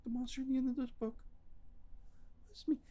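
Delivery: very high, quick, soft Grover voice; sheepish reveal like a muppet; Grover confessing. Retold or imitated. Grover admitting to the reader he is the monster, very high. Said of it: "It's like".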